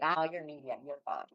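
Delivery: low, soft brush-off; dismissive mock official. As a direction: lightly mimic someone waving a patient away. Voicing a dismissive official to epileptics.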